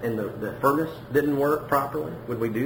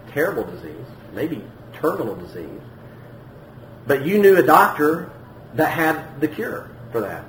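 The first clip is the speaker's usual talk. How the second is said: ordinary delivery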